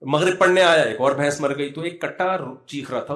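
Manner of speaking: normal talking voice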